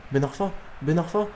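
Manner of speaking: nom répété plus haut, en montant; montée d'excitation. Real, not acted. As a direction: faire monter le suspense sur le joueur. Suit l'action qui monte. (suspense excité)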